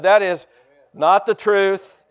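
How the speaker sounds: preacher driving home "not the truth", loud and slow; emphatic repeat. Real, not acted. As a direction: hammer "not the truth" slowly to the congregation. Preacher repeats denial emphatically for congregation.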